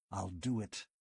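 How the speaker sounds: overwhelmed magician giving in; thick with emotion. A narrator's reading. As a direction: force it out through heavy emotion. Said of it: "His voice was thick with laboring emotions".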